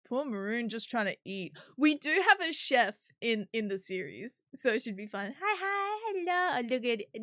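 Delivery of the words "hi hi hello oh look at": cooing greeting. Real, not acted. Greeting the character sweetly.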